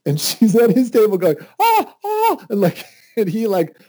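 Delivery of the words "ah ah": very high wordless squeal; mute excited squeal. Retold or imitated. Bride-to-be forbidden to talk, squealing. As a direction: squeal without words, bursting. Fiancée unable to speak.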